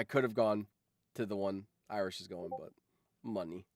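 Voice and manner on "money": usual speaking voice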